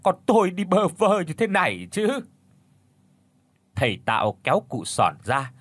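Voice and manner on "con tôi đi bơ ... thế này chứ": keen over the coffin in grief; sobbing lament. A narrator's reading. Widow wailing to her dead husband over his coffin, sobbing. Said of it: "khóc nức nở".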